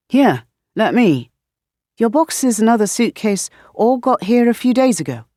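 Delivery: put-on chatty questions in a light British accent; a hint of a puzzled Brit; curious British roommate quizzing; lightly British roommate.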